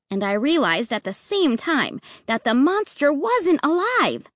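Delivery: plain reading voice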